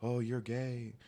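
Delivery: lightly voiced slow taunting hater; drawn-out mocking jeer. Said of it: "when people hate on me".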